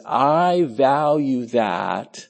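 drawn-out, weighty quote of the Lord; ağır, vurgulu alıntı. Acted. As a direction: stretch each word out as the Lord's pronouncement. Voicing the Lord to believers.

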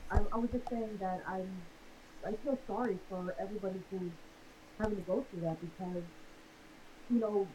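muffled voice, quiet and distant. Real, not acted. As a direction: sounds like talking through a hand over the mouth. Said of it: "you're a bit muffled".

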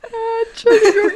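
coughing after swallowing wrong; words through a choke; choking on a drink. Real, not acted. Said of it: "ich hab mich grad verschluckt".

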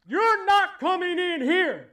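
high, loud, slow threatening voice; the enemy blocking the way; menacing enemy. Acted. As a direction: bar the door, threaten them. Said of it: "the enemy tells you".

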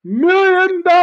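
very high, loud announcer call; grand entrance announcement. Acted. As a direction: belt it out for the crowd. Continuing the announcement.

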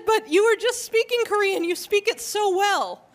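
high, emphatic retold compliment; imitated excited praise. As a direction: gush as the amazed woman insisting it's true. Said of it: "very excitedly".

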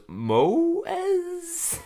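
high, stretched-out questioning quote; drawn-out question. Retold or imitated. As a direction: stretch it upward into a squeaky question. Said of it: "so she goes".

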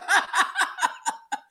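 high giddy laugh; bright high laugh. Real, not acted. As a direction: laugh it off, delighted. Giddy over the band sound.

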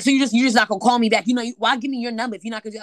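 loud, fast imitated rant; angry rant. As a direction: rattle off his complaint loudly and quickly. Caller ranting at her.

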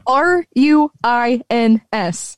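deliberate, separated letters; slow spelling. Shown as a demonstration. Host corrects friends on a word, spelling slowly.

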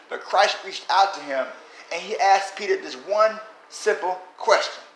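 ordinary talk, nothing marked

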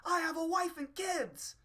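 very high, loud, slow furious shout; hammed-up squeaky rage, dangling in the air; angry little man. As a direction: scream to be put down. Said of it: "he'd be going".

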